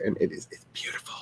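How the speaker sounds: whispered in awe; awed whisper, no voice. Real, not acted. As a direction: breathe it out in awe. Awed about Stonehenge.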